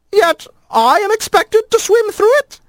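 very high, prim protest; a fussy lizard objecting; indignant lizard voice. A narrator's reading.

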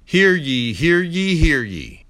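loud ringing proclamation call; town crier. Acted. Opening call of a radio segment.

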